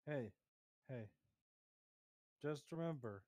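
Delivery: ordinary delivery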